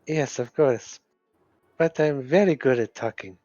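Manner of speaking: ordinary delivery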